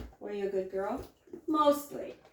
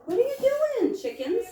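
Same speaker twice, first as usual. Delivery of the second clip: much higher, sing-song call to the chickens; high pet voice; 13 semitones higher, 4 dB louder than usual. Real, not acted. To the curious chickens, high sing-song pet talk. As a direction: coo at the birds in a high voice.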